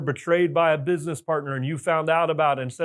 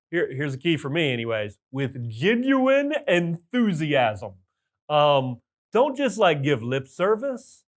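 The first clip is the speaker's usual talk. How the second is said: usual speaking voice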